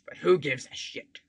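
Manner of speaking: ordinary talk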